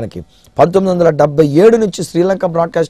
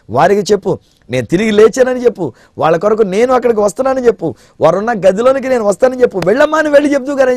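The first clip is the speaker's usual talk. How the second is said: building higher and louder to an urgent charge; urgent commission; 8 semitones higher, 3 dB louder than usual. Acted. Jesus sending Mary to the disciples, building higher and urgent. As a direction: drive each go and tell higher.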